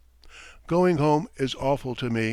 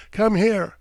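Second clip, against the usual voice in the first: calling out; 8 semitones higher, 6 dB louder than usual. A narrator's reading. Calling the boy over.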